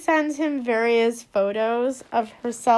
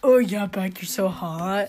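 mock lustful man; 5 semitones lower than usual. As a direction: echo a lusty boyfriend, a little low. Echoing the man's lustful quote.

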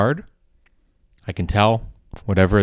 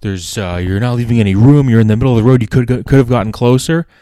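angry other driver; 3 semitones higher, 6 dB louder, faster than usual. Retold or imitated. The other driver blaming the comedian, loud annoyed complaint. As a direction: act out the pissed-off driver scolding you. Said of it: "he goes".

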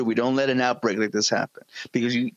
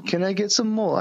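put-on English accent; 6 semitones higher than usual. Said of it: "I can do a fucking English accent".

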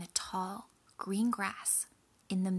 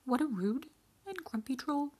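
high-pitched goat voice; middle goat; 6 semitones higher than usual. A narrator's reading. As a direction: grumble to yourself as a high-voiced little goat. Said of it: "thought the goat".

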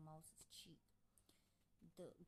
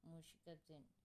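usual speaking voice

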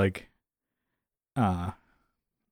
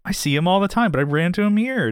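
much higher, put-on storytelling voice; made-up story; 9 semitones higher than usual. Schemer telling the girl about running into Jerry, put-on high voice. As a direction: pitch up and play-act a fake coincidence. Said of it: "make up the story".

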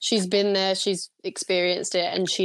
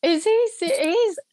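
higher, incredulous outburst; real disbelief; 9 semitones higher, 3 dB louder than usual. Real, not acted. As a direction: burst out in appalled disbelief. Outraged at Trump's claim.

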